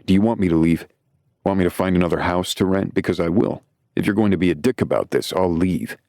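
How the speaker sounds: usual speaking voice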